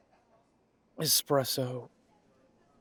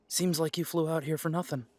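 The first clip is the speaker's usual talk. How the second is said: ordinary talk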